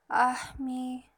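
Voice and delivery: lightly acted wistful complaint; sad sigh of words. A narrator's reading. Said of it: "said Cinderella sadly".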